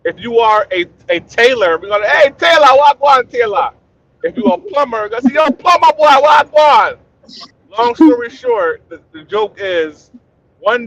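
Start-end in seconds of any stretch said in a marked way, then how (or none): 2.1-3.7 loud, high Jamaican greeting; Jamaican accent. Retold or imitated. Retelling the joke to the panel, hailing the electrician in a Jamaican accent. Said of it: "a lot of Jamaican people". 5.3-7.0 high, loud Jamaican shout; Jamaican accent. Retold or imitated. Hailing the plumber in a Jamaican accent, shouting. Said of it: "a lot of Jamaican people".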